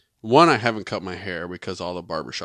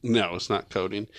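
usual speaking voice

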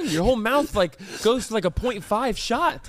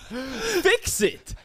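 laughing; 6 semitones higher, slower than usual. Real, not acted. Laughing at the lisp.

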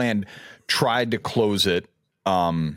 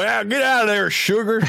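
put-on deep Southern accent; 12 semitones higher than usual. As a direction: shoo the sugar away in a big, high Southern accent. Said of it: "a deep Southern accent".

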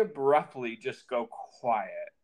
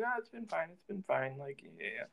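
curt mumble; 12 dB quieter, much faster than usual. Retold or imitated. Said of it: "He's like".

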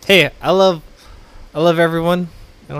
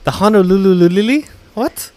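confused surprise; 6 semitones higher, 4 dB louder than usual. Retold or imitated. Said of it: "'Cause I was like".

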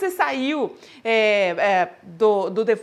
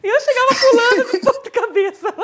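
laughing, high; 10 semitones higher, 4 dB louder than usual. Real, not acted. Host laughs describing herself.

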